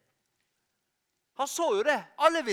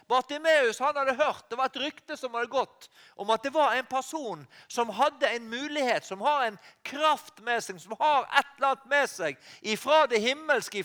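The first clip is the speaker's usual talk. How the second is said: normal talking voice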